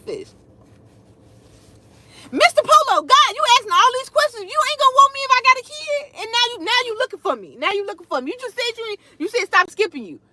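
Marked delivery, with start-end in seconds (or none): 2.3-10.2 high, sassy teasing; playful teasing. Acted. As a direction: tease him with sass. Teasing a commenter on live.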